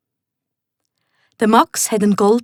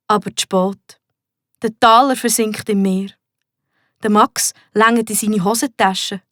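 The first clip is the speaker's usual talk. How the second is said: ordinary delivery, nothing marked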